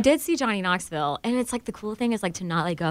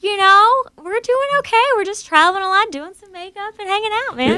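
high put-on sassy voice; character voice; 11 semitones higher than usual. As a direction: keep the voice high and twangy. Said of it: "character voices come in and out".

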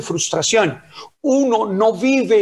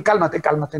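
soothing calm down; 5 semitones lower than usual. Pretend. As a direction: settle them down gently.

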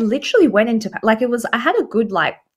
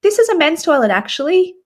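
imitating a cheery Canadian man, high and louder; friendly man; 12 semitones higher, 4 dB louder than usual. Retelling the man at the urinal, friendly and high. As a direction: mimic his overly friendly correction. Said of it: "he said it in the most friendliest tone".

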